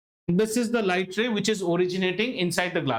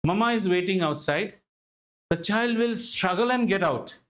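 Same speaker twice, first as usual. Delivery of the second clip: ordinary talk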